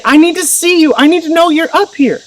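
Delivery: high-pitched imitation of an anxious child; four-year-old boy. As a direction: plead like a small son needing a parent. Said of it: "in his four-year-old mind".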